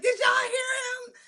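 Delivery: high, slow crying shout; shocked shriek. Retold or imitated. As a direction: shriek in disbelief. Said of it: "she's like".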